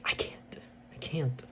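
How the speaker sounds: unvoiced, hushed refusal; soft whispered refusal. Bear refusing secretly.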